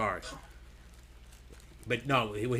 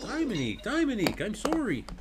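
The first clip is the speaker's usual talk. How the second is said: high, swooping mock Canadian; mad Canadian; 8 semitones higher, 3 dB quieter than usual. Needling over the name Dominique, mock Canadian accent. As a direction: lay on the Canadian vowels, high and softly. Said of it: "turn to Canadian all of a sudden".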